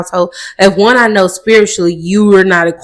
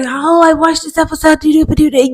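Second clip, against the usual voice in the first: mock chatty viewer; 8 semitones higher than usual. Mimicking viewers texting comments.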